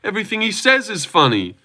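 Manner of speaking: high, gushing fake admiration; hammed-up fawning praise; mock amazement. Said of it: "it's not like".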